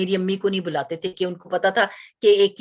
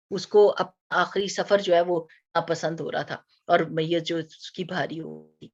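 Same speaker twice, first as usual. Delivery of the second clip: ordinary talk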